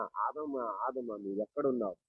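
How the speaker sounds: low, hushed call to someone hiding; softly acted calling. God seeking the hiding Adam.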